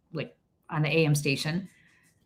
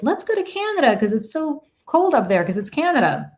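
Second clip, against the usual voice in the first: mock idiot reasoning; 8 semitones higher, 4 dB louder than usual. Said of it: "we're like".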